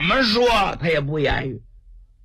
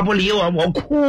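ordinary delivery

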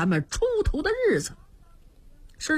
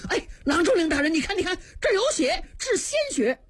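fast, rising higher to a near cry; startled alarm; 6 semitones higher, faster than usual. Acted. Servant startled, pointing out blood to officials.

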